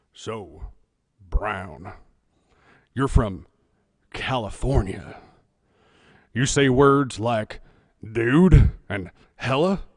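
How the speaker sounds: low, slow teasing officer voice; boss voice. Retold or imitated. Boss teasing the California recruit, low and slow.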